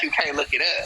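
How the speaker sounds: deep dismissive friend voice; low mock friend voice. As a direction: drop low, brush her off.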